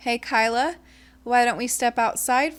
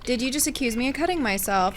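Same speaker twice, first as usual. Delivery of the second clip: ordinary talk, nothing marked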